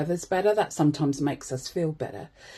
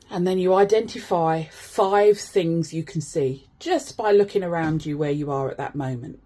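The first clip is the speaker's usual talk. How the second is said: usual speaking voice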